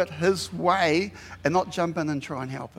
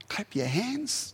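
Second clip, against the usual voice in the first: slow, softer, higher, with a rise at the end of "hands"; drawn-out repeat; 4 semitones higher, 6 dB quieter, slower than usual. Shown as a demonstration. Preacher repeats the psalm to contrast with pouting, drawn-out. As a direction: draw out "Clap your hands" after a sigh, lifting the last word. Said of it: "That's not pouting, that's shouting".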